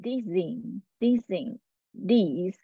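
dizzying stretched slowly, then diz; teacher modeling dizzying. Shown as a demonstration. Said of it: "很美的音叫".

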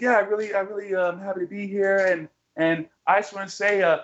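high mock fan voice ending in a jab; woman fan voice. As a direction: play a cheerful fan who turns to insult.

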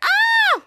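high shrieking scream of fright; high fearful scream. Acted. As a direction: scream in terror. Scream of fear.

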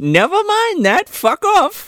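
high dismissive granny; old lady voice. Retold or imitated. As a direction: brush him off as the old woman. Old lady brushing him off.

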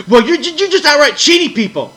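high, loud accusation; hammed-up angry accusation; mock outraged customer. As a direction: shriek it like an offended customer.

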